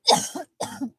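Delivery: a high, strained real cough. Real, not acted. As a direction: cough that cuts off the sign-off. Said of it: "I've overheated".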